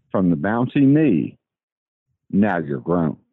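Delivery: measured poem reading with long pauses between lines; solemnly paced amateur sonnet reading; poem recitation. Acted. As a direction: recite your own verse line by line, letting each phrase land. Caller reads own poem aloud.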